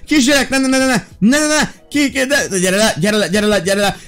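frantic yelling. Real, not acted. Child climbing somewhere forbidden. (kétségbeesett kiabálás)

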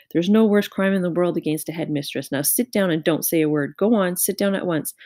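plain reading voice

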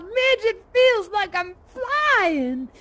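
high, loud, drawn-out holler; retold shout to a sister, stretched and soaring; exultant yell. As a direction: yell it slowly up at the sky. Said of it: "I looked up at the sky and I yelled".

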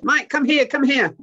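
high, loud urgent call; calling over. Retold or imitated. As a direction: call someone over from a distance. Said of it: "the team called me over".

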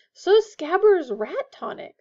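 high, loud, slow comeback; irritated retort. A narrator's reading. Ron searches his trunk mid-quarrel, irritated. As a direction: throw the complaint back.

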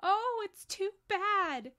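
high, loud drawn-out lament; upset child's cry; distraught. A narrator's reading. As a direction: wail it slowly in outrage and grief. Lucy grieving the missing body.

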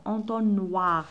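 Entonnoir drawn out; slow model word. Shown as a demonstration. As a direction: stretch Entonnoir so pupils hear wa. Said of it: "Qu'est-ce que j'entends?". (Entonnoir ببطء)

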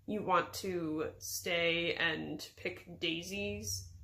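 low, very slow disbelieving question; flat, incredulous. A narrator's reading. Wizard questioning the flower picking, flat and incredulous. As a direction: draw it out flatly in disbelief. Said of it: "flat, incredulous tone".

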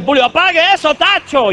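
high, loud foreign pastor's yell; mock pastor shout. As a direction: shout it with a clumsy accent. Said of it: "te digan".

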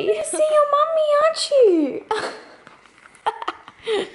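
high, quick cooing to a dog; pet-talk voice. Real, not acted. Talking to her dog.

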